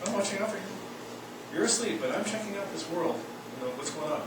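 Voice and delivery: soft, hushed inner watcher; observer self voice. Acted. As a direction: murmur it like a quiet inner guardian.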